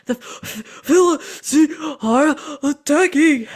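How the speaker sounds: gasping, drawn-out, high and loud; out of breath. Retold or imitated. Winded messenger bringing battle news, gasping loudly. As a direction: pant hard between broken words. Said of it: "he's out of breath, so it's sort of like".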